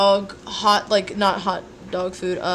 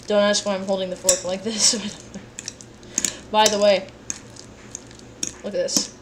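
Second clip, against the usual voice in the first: usual speaking voice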